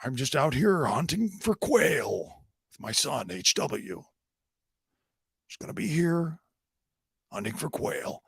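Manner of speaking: slow, drawn-out put-on character voice; put-on film character acceptance speech. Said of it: "he didn't get up there going like".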